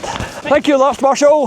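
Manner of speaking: high, loud call across the path; calling out thanks. Real, not acted. Thanking the marshal while running past, loud high call. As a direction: shout your thanks over to him.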